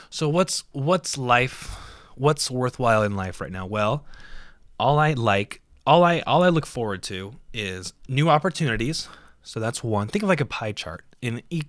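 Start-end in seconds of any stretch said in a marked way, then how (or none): none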